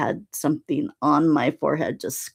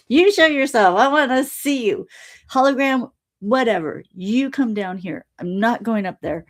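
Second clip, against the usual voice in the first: insistent demand; 6 semitones higher than usual. Retold or imitated. Retold argument, insisting to Alon.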